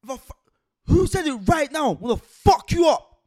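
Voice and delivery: furious roar, slow at the end; threatening yell; angry shout. Retold or imitated. Retold threat shouted at guards.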